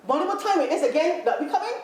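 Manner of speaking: calling across a room. Acted. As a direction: call it out like a hurried question to someone far off. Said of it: "shout across the carpet and say".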